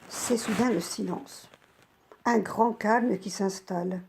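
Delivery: plain reading voice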